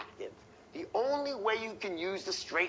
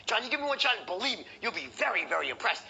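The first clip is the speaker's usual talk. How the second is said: quick, higher-pitched sales push; wrong pushy close; 4 semitones higher, faster than usual. Shown as a demonstration. Showing trainees how never to close, hurried and pushy. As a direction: rush the pitch, ending high on the question. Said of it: "You never wanna close like".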